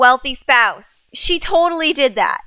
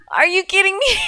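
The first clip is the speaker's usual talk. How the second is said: disbelief; 6 semitones higher, faster than usual. Real, not acted. Repeated disbelief.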